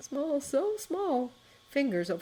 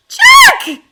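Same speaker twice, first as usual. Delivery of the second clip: put-on high, much louder child's scream; girl screaming his name; 20 dB louder than usual. A narrator's reading. Little sister screaming as her brother falls through the ice.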